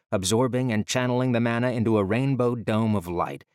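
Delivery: plain reading voice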